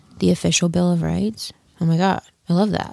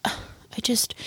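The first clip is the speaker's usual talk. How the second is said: sighing whisper; 4 semitones higher, 9 dB quieter than usual. Real, not acted. Sighing over packing stress.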